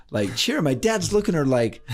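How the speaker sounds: ordinary talk, nothing marked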